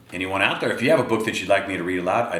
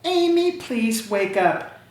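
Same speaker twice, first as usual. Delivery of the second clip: high, slow, sweet coaxing; very nicely; 14 semitones higher, slower than usual. Acted. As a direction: coax her awake so gently. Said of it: "said very nicely".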